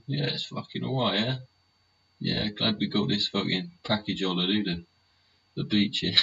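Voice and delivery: high, smug, mocking British holidaymaker, mildly played; mock a tourist lounging pleased on the beach.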